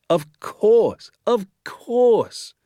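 high, loud, rising repeat; excited cry. A narrator's reading. Listeners suddenly understanding the doctor's hunch, excited cry. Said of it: "we cried".

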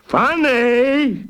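outraged echo. A narrator's reading.

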